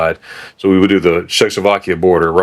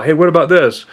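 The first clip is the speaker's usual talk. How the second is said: eager questions; 8 semitones higher than usual. Retold or imitated. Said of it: "They'll call me up".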